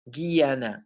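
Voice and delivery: "Guiana" as in Spanish, hard g then ia-na; Spanish-style pronunciation. Shown as a demonstration. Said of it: "Así se pronunciaría en español".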